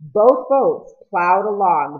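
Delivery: plain reading voice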